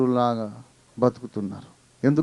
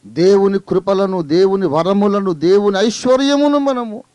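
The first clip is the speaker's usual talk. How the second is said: building, higher and louder exhortation; rising preaching; 7 semitones higher, 6 dB louder than usual. Acted. Preacher exhorts believers to worship, building to loud and high. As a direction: urge them on with growing force.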